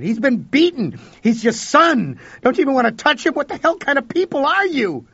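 high, loud outraged shouting at the parents; livid, pleading yell; furious shout. A narrator's reading. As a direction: hurl the accusation at them. Confronting cold parents, shouting.

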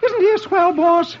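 very high comic voice; dazed admiring Dagwood. Acted. Bruised husband praising his boss, high and admiring. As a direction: pipe it out admiringly.

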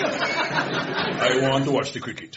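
put-on dad's slow West Indian accent. Dad wants to watch the cricket, West Indian accent. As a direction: mimic a West Indian father taking his time. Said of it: "In that accent".

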